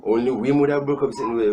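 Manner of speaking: ordinary talk, nothing marked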